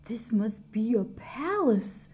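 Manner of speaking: breathy gasped whisper; awed whispered gasp. A narrator's reading. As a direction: whisper it in awe, almost no voice. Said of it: "Corduroy gasped".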